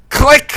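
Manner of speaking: loud, high seatbelt click; imitated click. Imitating Jay's seatbelt closing, loud click. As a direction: punch out a triumphant click.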